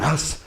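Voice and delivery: short hissed breath out; one quick "huss" exhale; strike breathing. Shown as a demonstration. Coach showing how to breathe on strikes, sharp exhale. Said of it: "we're just breathing".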